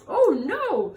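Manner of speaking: high, loud gentle dismay; surprised oh no. Acted. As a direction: react with surprise. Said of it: "said Mister Seahorse".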